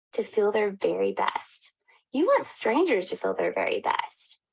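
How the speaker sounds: intimate whispered sincerity. Real, not acted. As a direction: hush it like a secret. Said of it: "whispered sincere point".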